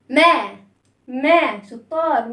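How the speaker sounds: normal talking voice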